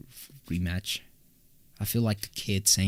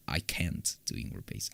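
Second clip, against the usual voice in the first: unvoiced whisper, much softer; 6 semitones lower, 9 dB quieter than usual. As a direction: breathe it out as an aside. Repeating the line two ways.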